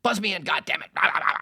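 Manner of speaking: loud, high imitation of an angry man; mock a furious rant at the intercom; pissed-off yelling. Locked-out host demands to be buzzed in, pissed off. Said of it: "And he's all pissed off".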